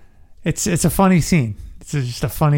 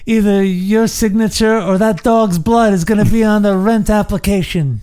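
raised, menacing mobster voice; pitched-up mock gangster menace; mock mafia threat; 5 semitones higher than usual. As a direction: lean on him like a gangster making a threat. Mock threat quoting Godfather line.

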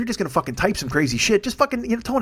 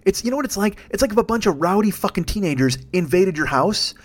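usual speaking voice

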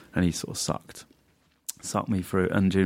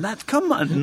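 mock outrage; slower than usual. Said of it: "he went mental and went".

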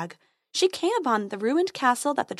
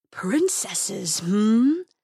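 sly dragon purr; amused menacing beast; much slower than usual. Acted.

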